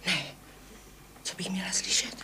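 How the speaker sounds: soft whispered question; puzzled whisper to the neighbour. Acted. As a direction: whisper back so no one hears. Answers the hushing neighbour.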